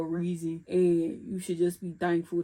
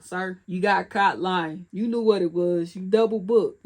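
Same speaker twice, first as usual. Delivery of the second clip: calling him out; 8 dB louder than usual. Real, not acted.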